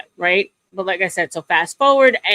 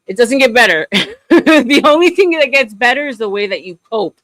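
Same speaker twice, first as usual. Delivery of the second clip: laughing; 4 semitones higher, 6 dB louder than usual. Real, not acted. Laughs while giving bleak life advice.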